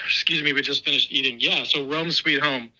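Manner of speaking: usual speaking voice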